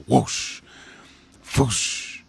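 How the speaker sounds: voiced swoosh sound effect; whoosh effect. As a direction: imitate a sudden rush of flame. Imitating the flames and vanishing.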